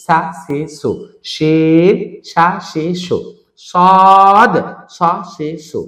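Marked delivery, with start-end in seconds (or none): 1.3-2.0 শিন loud, with a held vowel; drawn-out letter name. Shown as a demonstration. Teacher naming a letter for learners, drawn out. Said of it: "টেনে বলতে হবে". 3.7-4.5 সদ high, loud and held long; drawn-out letter name. Shown as a demonstration. Teacher naming a letter for learners, drawn out. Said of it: "টেনে বলতে হবে".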